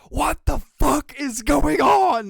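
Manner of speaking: loud, high, slow disbelieving yell; shocked yell. Retold or imitated. Streamer acting his reaction to a cheer, yelling in disbelief. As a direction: drag it out in outrage. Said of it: "it should be like".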